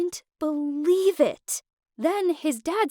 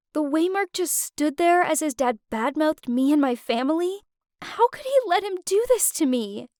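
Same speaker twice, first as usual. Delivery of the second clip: ordinary delivery, nothing marked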